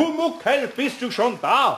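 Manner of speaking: höher und laut, langsam gerufen; rufen. Acted. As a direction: ruf laut nach Pumuckl. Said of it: "jetzt ruf mal". (Meister ruft langsam und laut nach Pumuckl)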